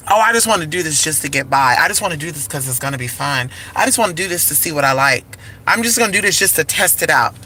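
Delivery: quick, low, offhand rattle; imitating someone rattling off excuses; mock excuses. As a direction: toss off each excuse fast and casually. Voices people's excuses to herself.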